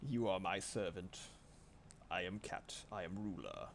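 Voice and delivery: imitating a haughty little ruler cat; imperious cat. Cat addresses its new servant, imperious. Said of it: "she was very".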